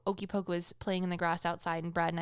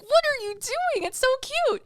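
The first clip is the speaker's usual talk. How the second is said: much higher, louder cutesy voice; cooing at baby; 6 dB louder than usual. Retold or imitated. Mother reacts to her baby son's noises: high cooing voice. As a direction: gush over the baby. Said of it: "And I'm just like".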